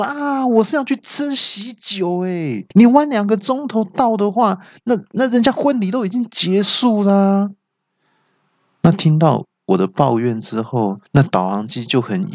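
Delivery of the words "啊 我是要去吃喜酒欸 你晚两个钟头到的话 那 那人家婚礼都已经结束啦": high-pitched exasperated outburst; hammed-up, far higher indignant protest; angry yell. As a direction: wail in mock outrage. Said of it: "那我就气到大叫说".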